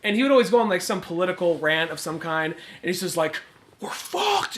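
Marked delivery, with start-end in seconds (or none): 3.8-4.6 imitating a breathy, barely voiced utterance; mouthed, exhaled words. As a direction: push the words out on breath, softer than usual. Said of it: "he does that thing where he's mouthing it".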